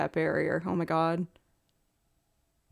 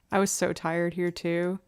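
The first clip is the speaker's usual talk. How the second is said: normal talking voice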